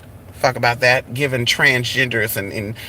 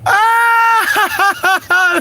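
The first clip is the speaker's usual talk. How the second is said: laughing shriek. Real, not acted.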